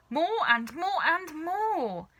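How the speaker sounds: slow, high, drawn-out glee; gleeful relish. A narrator's reading. Savoured repetition.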